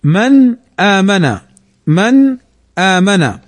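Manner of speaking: slow من آمن, "man aamana", n fully sounded; clear izhar. Shown as a demonstration. Teacher demonstrating izhar here, slow clear n in من آمن. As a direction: keep the n of من آمن distinct before the hamza. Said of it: "فنظهرها هنا".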